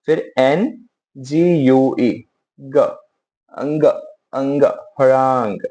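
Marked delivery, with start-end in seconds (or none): 4.3-5.6 हरांग built up from अंग, slowly; sounding out हरांग piece by piece; spelling it out. Shown as a demonstration. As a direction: say अंग then हरांग, ending in a falling tone. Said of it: "spelling देख लेते हैं".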